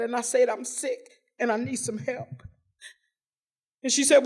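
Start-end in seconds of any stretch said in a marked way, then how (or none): 0.5-2.4 much softer, small voice asking for help; barely audible, worn-out plea for help; quiet plea. Retold or imitated. Begging stranger for help.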